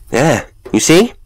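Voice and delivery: high, loud burst of vindication; exclaiming. A narrator's reading. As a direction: exclaim it to prove your point. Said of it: "he ejaculated".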